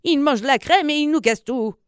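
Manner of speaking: put-on high, scolding old-woman voice; mock angry cry without real volume. A narrator's reading. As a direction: complain crossly in a high put-on voice. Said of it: "cria-t-elle dans les oreilles du vieux".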